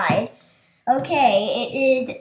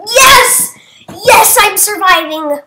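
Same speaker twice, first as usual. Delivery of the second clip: high, loud cheer; elated survival yell; triumphant shout; 6 semitones higher, 9 dB louder than usual. Real, not acted. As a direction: shout with joy at still being in. Celebrates surviving the vote.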